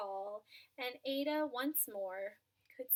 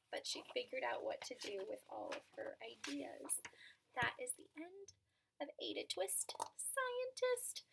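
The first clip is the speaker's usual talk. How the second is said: soft whisper to close the story; whispered ending; 8 dB quieter than usual. Real, not acted. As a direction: hush it like a bedtime end. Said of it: "closing the story softly".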